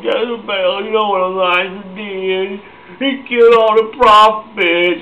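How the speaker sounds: put-on high, drawn-out whining like a little baby; mock crybaby running to report; Ahab. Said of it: "sounds like Ahab's a little baby".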